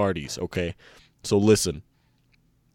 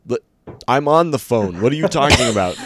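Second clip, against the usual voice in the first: exasperated loud; 4 semitones higher, 7 dB louder than usual. Acted. Exasperated vassal on phone.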